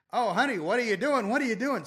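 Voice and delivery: high, sing-song mock dismay; dismayed husband. Husband not wanting to seem an idiot, dismayed. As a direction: imitate a husband scolding to save face. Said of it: "so the husband, who now doesn't wanna".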